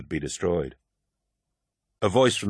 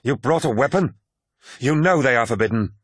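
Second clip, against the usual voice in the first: higher, indignant outburst; appalled, voice pitched up; alarmed cry; 5 semitones higher, 4 dB louder than usual. A narrator's reading. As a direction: exclaim in shocked reproach. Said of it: "cried Sergar Targost".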